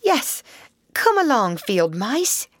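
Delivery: high, eager welcome; excited, high-pitched invitation; eager cry. A narrator's reading. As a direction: beckon them in eagerly. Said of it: "cried the Mole eagerly".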